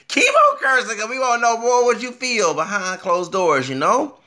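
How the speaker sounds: high, loud excited urging; loud egging on. Retold or imitated. Vlogger urging the cursing newscaster on, loud and excited. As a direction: belt it out, goading him to continue. Retold urging, repeated much louder.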